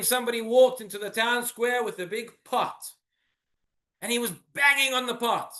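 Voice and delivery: ordinary delivery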